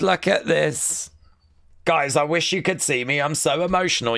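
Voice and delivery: usual speaking voice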